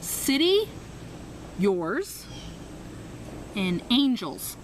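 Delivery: slow faltering prayer; halting broken prayer. Retold or imitated. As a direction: search for every word. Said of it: "This is literally how it went".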